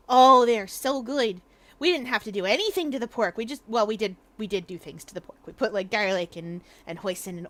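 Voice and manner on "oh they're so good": slow, loud "Oh, they're so good"; savoring delight. Real, not acted. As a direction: draw out the "Oh" with relish. Louder savoring take.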